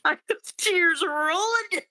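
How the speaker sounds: high, tearful wail; real crying. Real, not acted. Podcaster overcome by the scene, high tearful voice. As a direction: squeeze it out slowly through tears, pitched high. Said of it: "Okay, I'm crying again".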